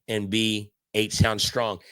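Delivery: ordinary talk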